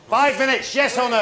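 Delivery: shouting. Real, not acted. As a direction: call for an answer across the kitchen. Chef demanding answer.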